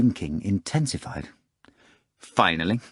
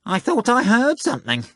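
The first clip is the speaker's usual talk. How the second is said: a smug cat boasting it will win; high showy talking cat, sure of victory; pompous cat; 14 semitones higher than usual. Acted. Cat Behemoth's voice.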